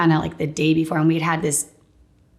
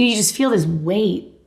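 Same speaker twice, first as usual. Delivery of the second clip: ordinary talk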